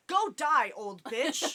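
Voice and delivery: shouted mock insult. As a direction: holler it as a comeback. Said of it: "Quiet down".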